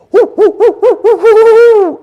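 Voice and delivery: loud barking hoot. Retold or imitated. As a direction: bark a warning at strangers. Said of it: "like a warning hoot".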